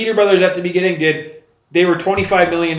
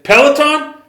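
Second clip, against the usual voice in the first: much higher, louder disbelieving echo; incredulous; 12 semitones higher, 6 dB louder, slower than usual. Retold or imitated. Dana hearing the sponsor's name, disbelieving. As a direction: toss the name back in shock. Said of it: "He's like".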